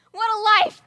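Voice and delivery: loud, high, bitter yelling; defiant shout. Acted. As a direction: throw the refusal out at full voice. Outburst against marriage.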